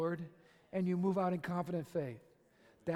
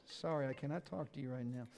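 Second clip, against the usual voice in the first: quiet aside; 8 semitones lower, 11 dB quieter than usual. Real, not acted. Aside to someone off-mic.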